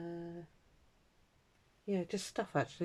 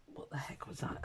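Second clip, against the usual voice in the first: startled whisper; 10 dB quieter than usual. Real, not acted. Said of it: "Scared the life out of me".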